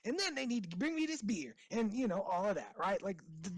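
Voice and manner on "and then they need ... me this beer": fast, high-pitched ranting imitation; mock screaming complaint. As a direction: rattle it off like an entitled whiner, without real shouting. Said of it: "We're screaming".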